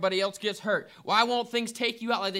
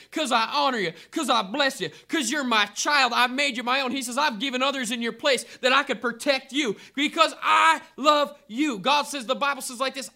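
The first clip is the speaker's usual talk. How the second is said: louder, climbing preacher's cry, every promise hammered home; preaching at full force; 3 semitones higher, 4 dB louder than usual. Acted. Preacher assuring the congregation of God's love, rising to a full-force cry. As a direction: rouse the congregation like a revival preacher.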